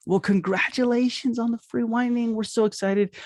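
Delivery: high, bubbly excitement; big congratulations. Shown as a demonstration. Receptionist congratulating the patient, bubbly and high. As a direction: sound thrilled for them. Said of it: "Make it a big deal".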